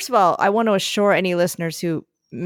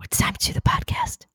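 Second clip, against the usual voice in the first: breathy hushed whisper; 4 dB quieter than usual. Real, not acted. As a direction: whisper so the puppy stays asleep. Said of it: "why are you whispering?".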